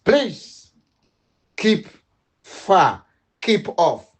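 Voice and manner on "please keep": normal talking voice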